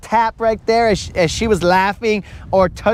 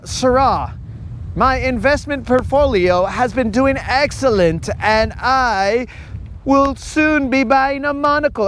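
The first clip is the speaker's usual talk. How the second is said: slightly raised, stiff mock-posh read of the portfolio line; a lightly voiced pompous rich guy; stiff fancy talker; 4 semitones higher than usual. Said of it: "I mean".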